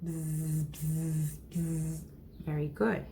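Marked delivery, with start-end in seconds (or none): 0.0-2.0 three low, soft buzzes; low humming "zzz" bursts; bee buzz. Shown as a demonstration. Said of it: "How does the bee sound?".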